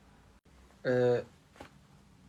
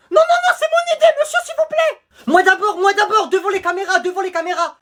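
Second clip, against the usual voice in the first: excited squeal; 7 semitones higher than usual. Acted. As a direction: beg to be first, voice up high. Pupil demanding camera attention.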